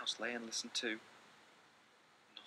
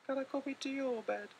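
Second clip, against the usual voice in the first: much higher pleading little voice; little mouse asking; 3 dB louder than usual. Acted. Little Mouse asking Big Mouse to share his bed, high small plea. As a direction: beg like a tiny mouse. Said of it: "asked Little Mouse".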